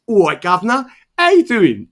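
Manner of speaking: loud, high-pitched put-on English accent; Green Torino's mock English accent. Friend taking off the accent on their show, loud mock English accent. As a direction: belt out a cheeky take-off of an English accent. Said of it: "he takes off the accent".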